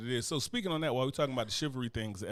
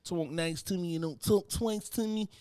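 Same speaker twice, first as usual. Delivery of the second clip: higher, slowed mock snap; mock grumpy Fox; 6 semitones higher, slower than usual. Husband imitating his wife woken at dawn, mock grumpy.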